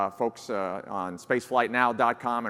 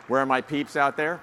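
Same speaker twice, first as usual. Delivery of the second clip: louder call to the crowd; 4 semitones higher, 6 dB louder than usual. Real, not acted. To the New Jersey attendees in the audience, a loud call-out. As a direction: shout out to the home-state crowd.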